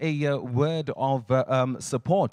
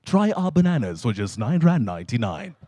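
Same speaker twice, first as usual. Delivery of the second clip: lower, smooth advert read; advert voiceover; 5 semitones lower than usual. Shown as a demonstration. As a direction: sell the bananas like a radio ad. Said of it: "I'd say things like".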